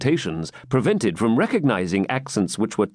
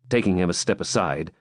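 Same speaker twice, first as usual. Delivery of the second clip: usual speaking voice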